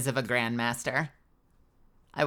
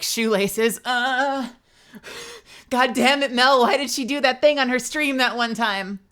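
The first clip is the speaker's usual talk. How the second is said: confused fan; 7 semitones higher, 4 dB louder than usual. Retold or imitated. Imitating a short-circuited fan.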